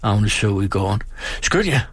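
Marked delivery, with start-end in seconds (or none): 1.4-1.8 higher, urgent shout; shouted order. A narrator's reading. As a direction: shout the order across the yard. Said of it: "råbte hun". (råber skynd jer ud i gården)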